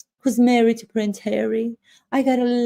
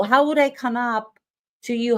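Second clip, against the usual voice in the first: ordinary delivery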